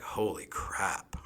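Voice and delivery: slow, breathless whisper of awe; stunned by the first bite, barely voiced; a hushed, amazed holy crap; awed whisper. Stunned by the food.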